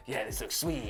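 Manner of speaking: low, quiet Batman growl; hushed gravel of a caped hero. Retold or imitated. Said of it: "And then Batman's just like".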